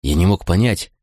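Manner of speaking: ordinary delivery, nothing marked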